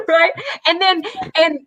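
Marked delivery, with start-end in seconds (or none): none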